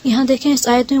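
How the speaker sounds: ordinary talk, nothing marked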